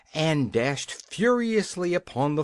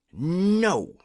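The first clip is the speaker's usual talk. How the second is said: higher, firm bold answer; bold refusal; 5 semitones higher, 3 dB louder than usual. A narrator's reading. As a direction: stand up to the king boldly. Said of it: "boldly answering the king".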